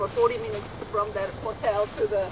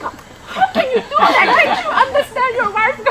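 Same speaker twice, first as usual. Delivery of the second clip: high, loud exasperation with a laugh; retold dark mood; 11 dB louder than usual. Wife still upset at smiling husband, loudly exasperated. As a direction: replay an indignant wife's outburst, hammed up. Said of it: "I was still in that dark mode".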